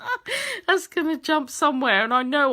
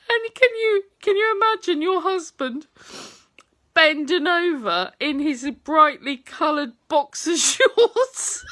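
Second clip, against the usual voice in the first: higher, giggly and half crying with laughter; laughing while talking; 5 semitones higher, 4 dB louder than usual. Real, not acted. Wife asking viewers to picture her husband, laughing. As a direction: push the words through the giggles.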